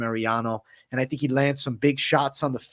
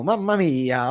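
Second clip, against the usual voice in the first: put-on Italian accent; slower than usual. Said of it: "He's coming out here with the Italian accent".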